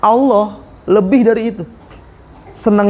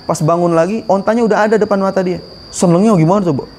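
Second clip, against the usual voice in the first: ordinary delivery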